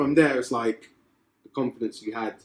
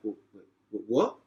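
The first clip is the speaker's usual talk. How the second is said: stammering surprise; slower than usual. Retold or imitated. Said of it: "And I was like".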